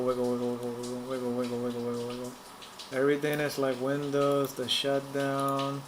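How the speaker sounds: ordinary talk, nothing marked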